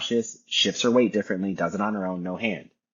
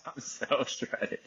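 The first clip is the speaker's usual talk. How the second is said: breathy aside; 5 semitones higher, 7 dB quieter, much slower than usual. Real, not acted. Laughing brag to himself.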